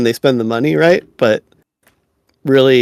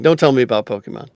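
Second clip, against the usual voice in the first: fast, quick retraction; rushed take-back; 3 semitones lower, faster than usual. Real, not acted. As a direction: blurt it out fast. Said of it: "I mean, just kidding".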